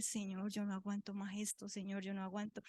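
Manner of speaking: hushed plea to God, no sobbing; retold tearful plea. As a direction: plead quietly, at the end of your strength. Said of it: "yo comencé a llorar y yo le dije".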